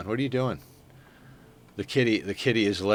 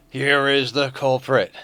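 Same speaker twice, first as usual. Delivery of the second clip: slow, drawn-out announcer voice; dramatic reveal; 4 semitones higher, 4 dB louder, slower than usual. Shown as a demonstration. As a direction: unveil the culprit slowly like a show host.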